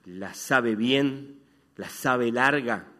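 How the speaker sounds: each word drawn out slowly; slow local saying. Shown as a demonstration. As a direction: savour the local phrase, slowly. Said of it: "como decimos en esta ciudad". (lento, saboreando el dicho porteño)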